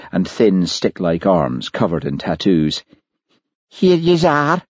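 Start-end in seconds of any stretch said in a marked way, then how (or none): 3.7-4.6 higher, broad Glaswegian voice; thick Glaswegian accent. Acted. Liz greets the visitors in a thick Glaswegian accent. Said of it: "in a thick Glaswegian accent".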